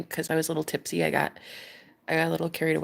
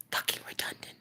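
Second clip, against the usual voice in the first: 1 whispered; 11 dB quieter, faster than usual. Real, not acted. Bitter aside after laugh.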